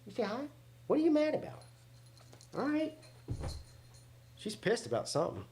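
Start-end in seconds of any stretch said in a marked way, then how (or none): 0.1-2.9 high sing-song pet voice. Real, not acted. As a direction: coo at the cat. Talking to the cat.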